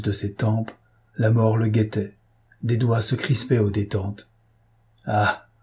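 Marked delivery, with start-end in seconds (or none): none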